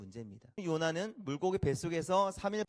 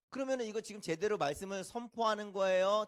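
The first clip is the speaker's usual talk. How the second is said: normal talking voice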